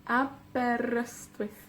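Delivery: Aberystwyth slowly split in four; soft, slow, separated Aberystwyth; syllable by syllable. Shown as a demonstration. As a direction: count out a, ber, yst, wyth in Aberystwyth. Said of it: "con cuatro sílabas".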